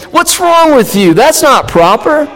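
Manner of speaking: high, snooty put-down; snobbish disdain. Retold or imitated. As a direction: look down your nose at them. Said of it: "snubbing their noses at them saying".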